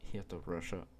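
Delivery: put-on a playful English accent on the DLC name Heart of Russia. Vlogger shows off his English accent naming a DLC, playful English accent. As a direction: say Heart of Russia like a native English speaker, quietly showing off. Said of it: "j'ai un accent incroyable".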